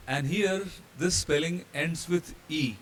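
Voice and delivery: ordinary delivery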